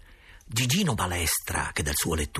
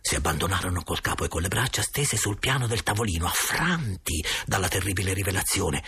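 ordinary delivery, nothing marked

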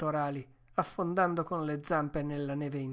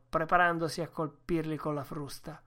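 plain reading voice

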